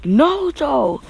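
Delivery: drawn-out high call of alarm; calling out a name. Acted. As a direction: shout his name as he collapses. Team calls out to fallen Naruto.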